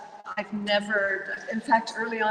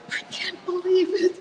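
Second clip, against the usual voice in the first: high, giddy disbelief near tears; can't believe it; 10 semitones higher than usual. Retold or imitated. Alone at home after the house-sit offer, giddy and overwhelmed. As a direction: squeal it out, overwhelmed with joy.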